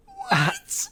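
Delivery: extremely high, squeaked disbelief; mock stunned squeal. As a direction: squeak it out in utter shock. Retold shock at the sign.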